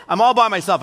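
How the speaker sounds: ordinary talk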